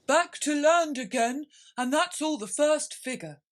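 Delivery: ordinary delivery, nothing marked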